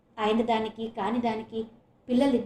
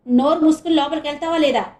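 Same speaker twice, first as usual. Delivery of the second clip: much louder, higher yell; furious shout; 4 semitones higher, 9 dB louder than usual. Acted. Mother screams at daughter to shut up and go inside. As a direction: bellow the threat. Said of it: "మళ్ళీ అరిచింది".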